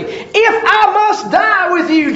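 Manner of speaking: emphatic vow. A narrator's reading. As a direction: swear it with all your chest. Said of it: "But he said emphatically".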